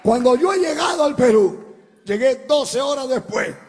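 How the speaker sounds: loud, high preaching cry over the music; fiery preaching. Acted. Preacher crying out the plane story to the church. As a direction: preach it out to the congregation, full force. Preaching testimony at full force.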